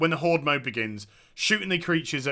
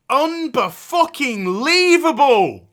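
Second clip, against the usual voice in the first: slow, higher, louder disbelief; exasperated disbelief; 7 semitones higher, 6 dB louder, slower than usual. Real, not acted. Streamer fed up with the game, slow exasperated outburst. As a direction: spit it out in disgust.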